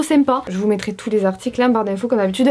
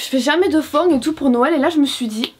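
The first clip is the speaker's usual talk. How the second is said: ordinary talk, nothing marked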